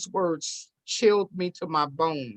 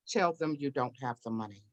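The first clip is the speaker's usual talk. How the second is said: low, soft, measured instruction; retold voice from within, lower and softer; quiet inner voice; 4 semitones lower, 7 dB quieter than usual. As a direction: say it low and gently. Inner voice of God heard.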